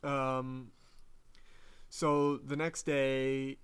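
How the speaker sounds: ordinary delivery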